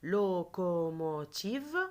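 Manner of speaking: "Locomotive" slow, syllables held evenly; "Locomotive" in long linked beats; stretched syllables. Shown as a demonstration. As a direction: model "Locomotive" slowly for pupils to repeat. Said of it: "Répétez après moi".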